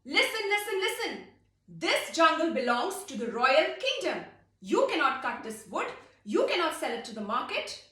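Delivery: loud, high official announcement; loud proclamation. Acted. King's men ordering the woodcutters, loud proclamation. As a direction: call it out like a royal herald.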